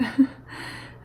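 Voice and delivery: airy little laugh; light, breathy chuckle; short laugh. Real, not acted. Poet finishing her explanation of the quotes, short breathy laugh.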